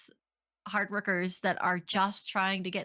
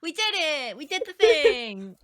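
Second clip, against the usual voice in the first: higher, louder mock cheer; mock triumphant; 6 semitones higher, 6 dB louder, slower than usual. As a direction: cheer it like a small victory. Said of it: "I'm like".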